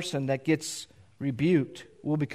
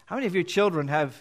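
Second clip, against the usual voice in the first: usual speaking voice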